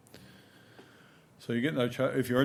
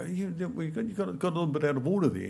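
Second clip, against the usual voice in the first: teasing adult; 6 semitones higher than usual. Retold or imitated. Adults tease the boys about their mixed-up gifts, pitched up and playful. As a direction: poke fun kindly at the kids.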